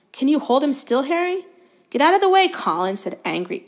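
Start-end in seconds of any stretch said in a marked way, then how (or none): 0.1-1.4 fascinated. A narrator's reading. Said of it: "fascinated and raising his camera". 1.9-3.0 sharp, loud angry snap. A narrator's reading. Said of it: "said Harry angrily".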